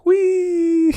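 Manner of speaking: shrill playful squeal; high gleeful whee. Pretend. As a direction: squeal with glee like sliding. Skater gliding sound.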